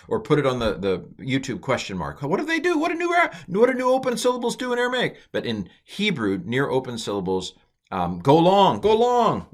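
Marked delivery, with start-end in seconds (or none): 2.2-5.2 very high mock-curious voice; high-pitched comic impersonation of a viewer; eager viewer question. 8.2-9.5 high, loud sing-out; raised, exaggerated teaching call; playful lengthening. Shown as a demonstration. Playfully acts out vowel lengthening.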